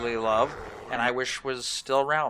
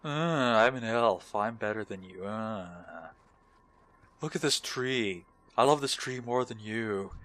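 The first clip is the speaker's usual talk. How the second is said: slow, drawn-out mock elf voice. Elf boasting of love for a tree, slow mocking drawl. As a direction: play a self-satisfied elf, slowly. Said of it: "been playing with the elves".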